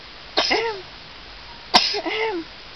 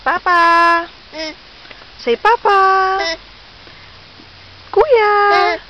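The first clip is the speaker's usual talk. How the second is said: high, raised calling voice; 4 semitones higher, 5 dB louder than usual. Pretend. Mother showing baby how to call papa, high calling. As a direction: sing out the names as if hailing family.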